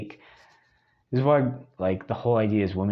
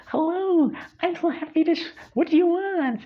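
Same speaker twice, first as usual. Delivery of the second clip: very high, over-bright mock waiter voice; fake cheerful waiter. Imitating a restaurant waiter greeting diners, high fake cheer. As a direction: ham up a fake-enthusiastic waiter. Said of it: "the waiter's like".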